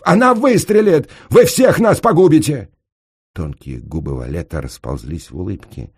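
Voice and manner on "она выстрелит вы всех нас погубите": very high, loud panicked wail; howling. A narrator's reading. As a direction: howl a warning at the top of your voice. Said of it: "взвыл пристав". (истошно взвыть)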